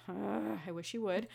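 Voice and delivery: ordinary talk, nothing marked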